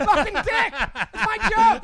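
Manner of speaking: high, loud laughing yell; laughing outrage. Real, not acted. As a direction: shout it through laughter, mock outraged. Joke stolen, mock outrage.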